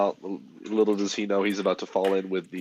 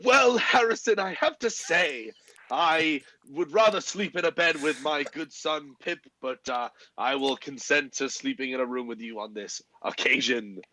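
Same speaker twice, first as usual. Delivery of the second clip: haughty aristocrat voice; 4 semitones higher than usual. Acted. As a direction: look down your nose at him. [name]'s haughty in-character reply.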